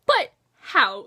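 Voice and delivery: mock outrage at the news; incredulous. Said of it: "I'm just like".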